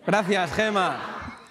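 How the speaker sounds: muy lento, alargando las sílabas; saludo a coro. Shown as a demonstration. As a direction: alarga el agradecimiento para que lo coree el público. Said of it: "A la de tres, tos". (grito colectivo alargado)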